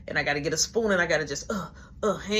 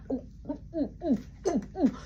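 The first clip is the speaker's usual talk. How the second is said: choking whoops; 7 semitones higher, 5 dB quieter, faster than usual. Retold or imitated. Choking on the drink.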